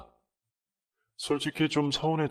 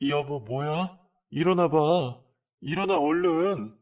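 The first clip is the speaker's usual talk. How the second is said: urgent waking; 8 semitones higher, 5 dB louder, slower than usual. Retold or imitated. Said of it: "하면서 남편을 깨웠고".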